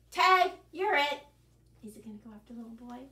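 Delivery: plain reading voice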